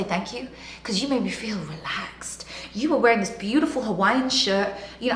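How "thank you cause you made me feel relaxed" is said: soft unvoiced whisper of thanks; hushed grateful whisper. Quoting thanks in a soft hush.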